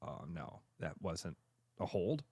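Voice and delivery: low, very soft mock admission; sheepish backing down. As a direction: mumble it like a fan caught being wrong. Fan backs down after replay.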